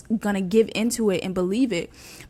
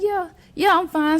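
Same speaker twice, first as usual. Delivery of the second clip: high-pitched, too-quick reassurance; pretending to be fine; 9 semitones higher, 4 dB louder than usual. Answering auntie who asked if okay, pretending to be fine. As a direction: imitate a put-on "all okay" voice. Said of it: "still trying to be like".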